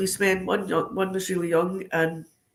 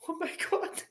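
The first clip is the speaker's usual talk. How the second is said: laughing panic; 12 semitones higher, 3 dB louder than usual. Retold or imitated. Retelling own panic, laughing.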